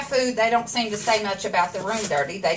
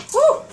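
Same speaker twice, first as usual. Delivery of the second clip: loud, high-pitched whew; sharp whew from stinging eyes; 5 dB louder than usual. Real, not acted. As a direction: blow out a high, relieving whoop. Eyes burning from the onions.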